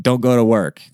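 usual speaking voice

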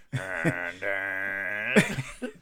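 long, drawn-out mock call; imitated lingering taunt; parting call. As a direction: stretch both words out as if trailing behind a departing car. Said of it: "as they're leaving, there's just a".

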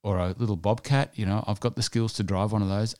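ordinary talk

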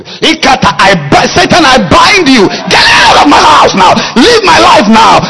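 high, loud commanding shout; binding prayer shout. Shown as a demonstration. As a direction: command Satan out forcefully. Demo prayer binding Satan.

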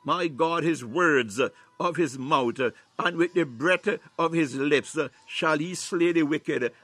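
ordinary delivery

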